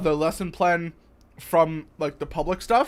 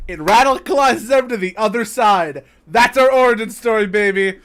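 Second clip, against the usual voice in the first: louder and higher, playful boast; loud, triumphant proclamation; 4 semitones higher, 8 dB louder than usual. Real, not acted. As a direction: proclaim it big, like a rallying punchline. Hammy punchline about their own origin.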